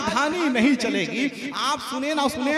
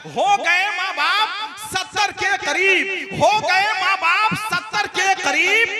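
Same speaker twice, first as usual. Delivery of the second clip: poetic recital; 6 semitones higher than usual. Acted. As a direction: stretch हो out and lift it. Reciting new couplet.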